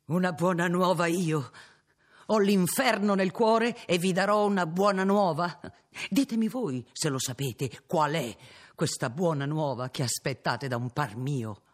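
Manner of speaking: loud, bitter, incredulous retort; bitter outburst. Acted. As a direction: fling the words back, stung. Innominato rejects the good news.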